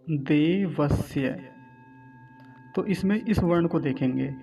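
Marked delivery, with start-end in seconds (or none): 0.1-1.3 slow देवस्य with a clear dental s in स्य. Shown as a demonstration. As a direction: draw out देवस्य, keeping स a clean s. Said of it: "दंतीय सकार". (देवस्य, दंतीय स के साथ धीमे)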